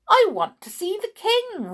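high, loud smiling voice. A narrator's reading. Little visitor asks the soldier for the king, smiling. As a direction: say it brightly through a smile. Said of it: "smiled little Miss Sunshine".

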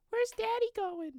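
high-pitched imitation of a kid; child's voice. Astronaut's kid asks after Dad, imitated child voice. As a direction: put on a little kid's high voice. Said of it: "something about his kids".

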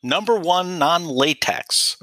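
drawn-out pitchman voice. Pretend. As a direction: announce it like a commercial. Product pitch voice.

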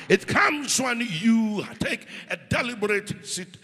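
loud fervent preacher calling out; preaching at full force. Acted. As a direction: preach full-voiced, rousing the church. Calls for praise and declarations.

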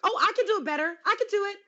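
high, mocking boastful voice; mocking Molly. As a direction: parody her one-upping. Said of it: "one up that guy".

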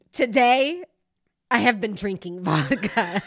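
loud, emphatic quote with a pause after the first word; movie line declaimed. Acted. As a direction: announce it like a famous line. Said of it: "My favorite line of the movie".

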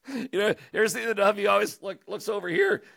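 ordinary talk

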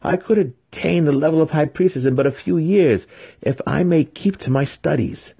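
daring protest. A narrator's reading. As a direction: argue your case to your mother. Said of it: "Vienna dared to protest".

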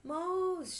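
high, loud long cry; shouted. A narrator's reading. Father sees the mouse, shouted. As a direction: shout the alarm on one stretched word. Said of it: "shouted father".

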